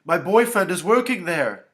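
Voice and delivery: loud, high, panicked exclamation; distraught young woman's cry. A narrator's reading. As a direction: blurt it out in horror. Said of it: "Tara cried out".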